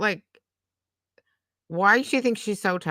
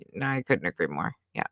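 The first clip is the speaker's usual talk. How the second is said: ordinary talk, nothing marked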